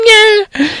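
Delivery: put-on a squeaky, sneering old caretaker; high caretaker voice. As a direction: jeer it up high.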